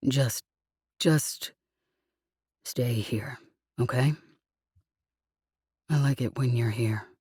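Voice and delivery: tender sleepy plea. Acted. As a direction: beg her gently to stay. He pleads softly.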